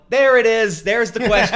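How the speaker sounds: loud, high, laughing "there it is"; loud laughing gotcha. Retold or imitated. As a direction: triumphantly call out the salesman's question, laughing. Retelling comeback to salesman.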